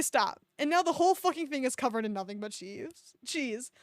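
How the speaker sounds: ordinary talk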